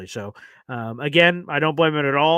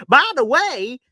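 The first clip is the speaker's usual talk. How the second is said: put-on Southern accent; 8 semitones higher, 6 dB louder, slower than usual. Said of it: "my Southern accent coming out".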